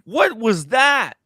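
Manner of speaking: real startled outburst. Real, not acted. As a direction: react in real surprise, stretching the question. Startled by the video.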